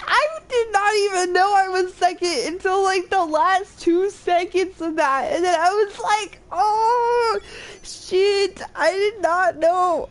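high, loud, still giddy from the finish; excited. Real, not acted. Streamer telling chat about a surprise second place, excited. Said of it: "Oh, that's exciting".

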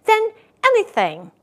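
high, loud eager claim; insist, eager to prove it; insistent little rabbit. A narrator's reading. Little rabbit insisting to the wild rabbit, high and loud. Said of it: "said the little rabbit".

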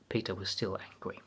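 plain reading voice

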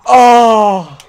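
high loud gasp of shock; real shout. Real, not acted. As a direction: blurt it out at the chance. Near miss in game.

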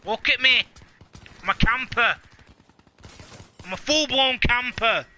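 loud, high mock bragging; hammed-up camper boast. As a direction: shout it like a proud show-off.

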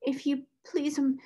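put-on timid, high-pitched servant girl; repressed crying. A narrator's reading. Scullery maid caught by the angry headmistress, tearfully timid. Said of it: "red with repressed crying".

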